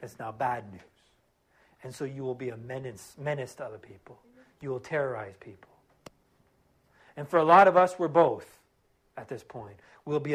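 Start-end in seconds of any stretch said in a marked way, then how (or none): 2.2-5.7 hushed warning. Real, not acted. Preacher drops to a warning.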